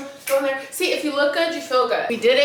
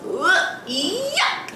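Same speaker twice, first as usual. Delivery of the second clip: disgusted ugh; 7 semitones higher than usual. Real, not acted. Disgust at rats.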